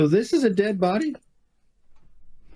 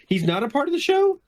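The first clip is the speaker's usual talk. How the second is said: incredulous repeat; 7 semitones higher than usual. Acted. Questioning whether the body was part of the show, incredulous. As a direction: pitch climbing high through to SHOW.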